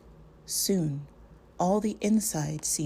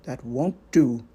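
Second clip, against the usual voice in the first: lower, louder and stern; 4 semitones lower, 7 dB louder than usual. Acted. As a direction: cut him off sternly. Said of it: "said Gandalf sternly".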